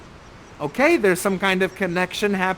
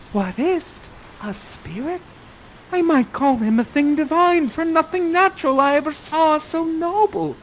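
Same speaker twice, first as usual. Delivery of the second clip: higher, lightly voiced young woman; awed Miranda; 9 semitones higher, 3 dB louder than usual. Host feeding Miranda her lines with awe. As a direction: breathe it out in amazement. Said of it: "you say".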